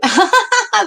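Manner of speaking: high, loud cackling laugh; real high laugh, falling away; burst of laughter. Real, not acted. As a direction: crack up in a high-pitched burst. Amused by her own Saint Vincent slip.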